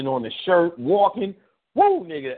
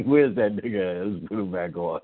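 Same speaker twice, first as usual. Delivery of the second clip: ordinary talk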